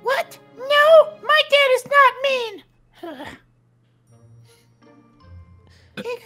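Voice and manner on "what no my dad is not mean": loud, drawn-out indignant protest. Acted. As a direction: defend your dad at full volume. Son indignantly defends his dad.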